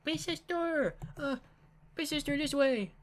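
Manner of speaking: put-on high little-girl call; little sister Mari. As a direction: call her over in a high childish voice. Mari calling her sister over.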